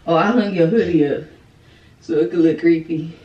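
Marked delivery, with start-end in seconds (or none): none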